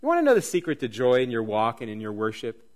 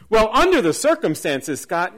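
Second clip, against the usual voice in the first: louder, higher mock objection; raised-voice excuse put to the preacher; protesting objector; 5 semitones higher, 7 dB louder than usual. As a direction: voice a listener pushing back. Voices an objecting listener, then answers.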